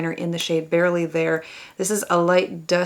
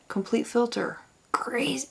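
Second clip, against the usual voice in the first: hushed, breathless whisper of amazement; soft stunned whisper; awed whisper; 4 semitones higher, 7 dB quieter than usual. Real, not acted. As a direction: whisper it like a secret wonder. Awed at powder's filter effect.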